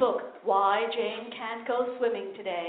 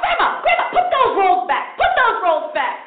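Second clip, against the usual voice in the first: high, loud slow scold; family scolding; 8 semitones higher, 7 dB louder than usual. Retold or imitated. Family at a wedding scolding grandma, loud. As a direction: call grandma off the bread. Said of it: "They're like".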